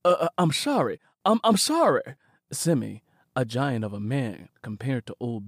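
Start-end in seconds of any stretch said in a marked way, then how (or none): 0.0-2.2 scared apology. Acted. Frightened Simi apologizing.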